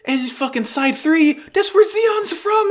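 pompous official voice, high and slow; squeaky mock official; high put-on voice. Admiral speech in recap.

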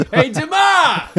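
high mock heckle across the arena; heckling shout. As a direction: yell at him like a Boston fan. Heckling player from the stands.

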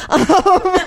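high giggle fading into um; laughing. Real, not acted. Telling the hero story to co-host, giggling. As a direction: laugh at yourself, embarrassed.

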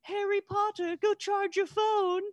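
very high mock yell, held back in volume; imitated girl yelling. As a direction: mimic a teen girl calling across a campsite, way up in pitch. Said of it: "Just this girl yelling".

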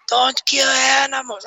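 put-on high, slow wail with a Mexican accent; Mexican-accented ghost. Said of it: "why I just turned Mexican".

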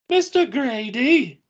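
high, slow British voice; British accent. Acted. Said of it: "When did we become British?".

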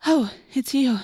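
put-on startled girl recognizing a friend; startled relief. A narrator's reading. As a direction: react with relieved surprise. Startled Cassie recognizes the dog.

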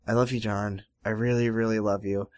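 low, soft, unenthusiastic love line; hushed, low-key confession; underplayed declaration. Shown as a demonstration. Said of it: "she doesn't say it like that. She says".

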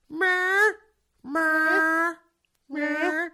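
high mocking meow; mock the bad cat noise. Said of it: "sounds like a dying calf".

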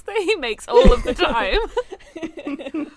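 high, loud, slow laughing words. Real, not acted. Laughing while reading a line.

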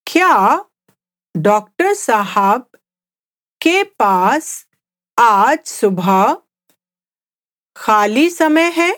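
slow Hindi question in short chunks, rising at the end. Shown as a demonstration. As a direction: pause after each phrase for learners. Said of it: "let's just speak at a slower pace".

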